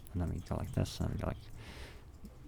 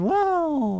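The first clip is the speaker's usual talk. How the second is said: much higher mock amazed wow; 7 dB louder than usual. Joking to viewers about the decoration, mock amazed wow. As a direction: fake awe, way up high.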